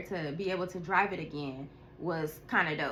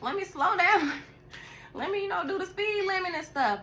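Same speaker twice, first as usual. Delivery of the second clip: high-pitched nervous self-talk; cautious self-talk; 11 semitones higher than usual. Retold or imitated. After seeing a crash vision, high nervous self-warning. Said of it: "So I was like".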